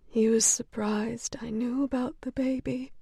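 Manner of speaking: soft unvoiced whisper. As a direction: keep it secret, barely voiced. Whispering patient confiding.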